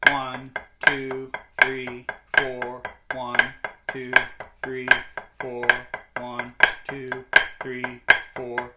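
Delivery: even, metronomic count on the beat; counting in time. Shown as a demonstration. Drum teacher counting along with the grid pattern, counting in time. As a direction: count out the beat, evenly spaced.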